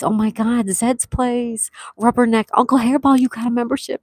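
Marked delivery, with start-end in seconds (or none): none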